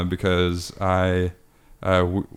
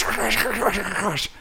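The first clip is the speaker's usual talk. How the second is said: high mock crash sounds; boy mimicking his sister's bike crashing, high; mimicked crash noises; 11 semitones higher, slower than usual.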